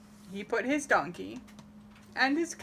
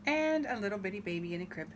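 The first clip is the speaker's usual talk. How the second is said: ordinary delivery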